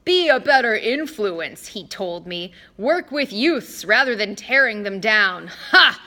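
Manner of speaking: Mister D pouting about his punishment; pouting kid. Acted. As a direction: sulk like a little kid. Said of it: "sounded about six years old, like a pouting little kid".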